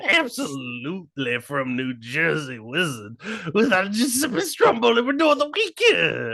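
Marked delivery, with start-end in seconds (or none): 3.5-6.3 hyped announcer. Acted.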